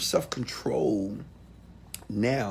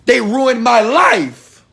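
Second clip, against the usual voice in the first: louder and higher, stretching out the last words slowly; imitating a hurt listener's slow, loud complaint; mock anguished protest; 9 semitones higher, 10 dB louder, slower than usual. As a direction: wail that they ruined your life. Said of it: "you say".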